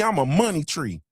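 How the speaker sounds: ordinary talk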